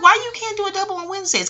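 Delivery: very high, outraged question; incredulous. Retold or imitated. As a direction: act baffled and put out. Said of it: "I'm like".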